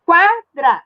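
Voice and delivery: "Quadra" split into qua... dra; syllable by syllable. Shown as a demonstration. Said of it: "Eu separei as sílabas".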